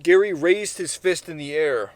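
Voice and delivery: usual speaking voice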